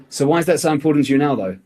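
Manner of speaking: fast question rattled off with no pause at all; rushed, no pauses. Shown as a demonstration. Sales coach shows a rushed question to a prospect, fast and unbroken. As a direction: fire the question out in one fast breath, leaving no gap. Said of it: "by using pauses in a phrase".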